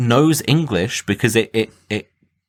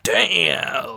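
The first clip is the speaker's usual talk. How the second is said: higher, drawn-out admiring exclamation; Tracy Morgan; 11 semitones higher than usual. Retold or imitated. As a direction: imitate a smitten cartoon character, stretching the word. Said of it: "he's introduced as being like".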